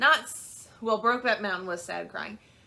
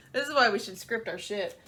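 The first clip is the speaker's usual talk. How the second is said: ordinary talk, nothing marked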